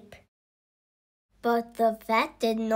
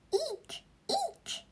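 tiny squeaking voice, much higher and softer; hamster squeak; 10 dB quieter than usual. Acted. The hamster joining the noise, tiny high squeaks.

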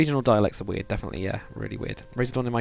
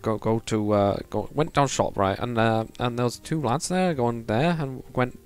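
imitating a mumbled northern English accent; put-on Preston speech with words missing. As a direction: ramble in thick Preston dialect, swallowing words. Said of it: "that's part of his accent".

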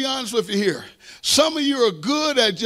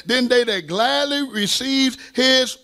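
very slow, deliberate verse quotation; drawn-out pulpit proclamation; emphatic scripture reading; much slower than usual. Acted. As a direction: weigh out each word of the verse. Quotes the verse slowly for emphasis.

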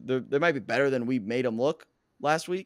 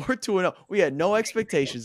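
high giddy laugh on it; laughing, gleeful; 7 semitones higher, 4 dB louder than usual. Real, not acted. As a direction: gloat with a laugh. Repeats record, laughing.

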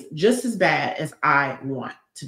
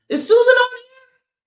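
excited calling out; 8 dB louder, slower than usual. Real, not acted. Calling for a viewer.

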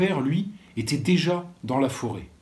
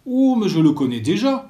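put-on louder, higher excited child's cry; eager cry; 8 semitones higher, 8 dB louder than usual. Little boy telling the teacher he knows the alphabet, eager cry. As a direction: burst out eagerly, proud to know it. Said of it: "s'écria Philippoc".

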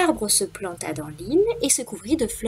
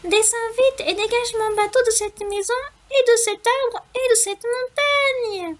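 high squeaky mouse voice, slowing at the end; little mouse voice, slowing; 12 semitones higher, 4 dB louder than usual. A narrator's reading. Mouse pleading with the wind, high and slowing down. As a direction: squeak it, drawing out the last words. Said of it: "s'écria le souriceau".

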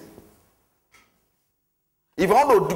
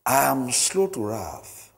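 slow, lower, deliberate declaration; scripture confession; 5 semitones lower, 3 dB quieter, much slower than usual. Acted. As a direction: declare it word by word like a creed.

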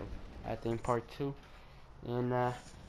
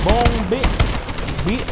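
high, loud victory shout; excited cheer; 7 semitones higher, 7 dB louder than usual. Real, not acted. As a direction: shout it out as the hit lands. Celebrating a hit.

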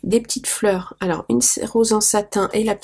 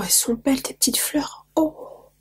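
soft whisper; 4 semitones higher, 4 dB quieter than usual. Real, not acted. Admiring the handmade flowers.

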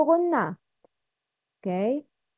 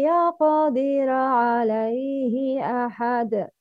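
Quran recitation. Acted. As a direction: recite with measured tajweed. Recites Surah Al-Balad.

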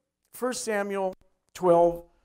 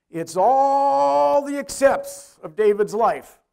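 louder, slow, every word leaned on; slow emphatic proclamation; 4 semitones higher, 6 dB louder, much slower than usual. Acted. Preacher booming the point to the congregation, slow and loud. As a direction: preach it out slowly with weight.

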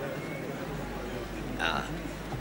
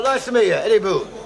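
usual speaking voice

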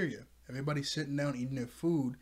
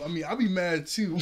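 ordinary talk

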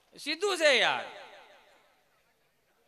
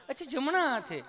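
higher, sweet polite nurse; nurse's voice; 4 semitones higher than usual. Retold or imitated. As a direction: gently quiz the patient. (નર્સનો ઊંચો, મીઠો અવાજ)

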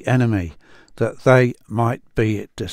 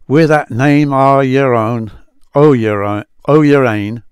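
put-on louder, effortful Scots accent on the old words; 3 semitones higher, 7 dB louder than usual. Rereading the Psalm phrase for viewers in an amateur Scots accent. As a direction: lean into the Scots vowels, a touch laboured. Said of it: "excuse my accent".

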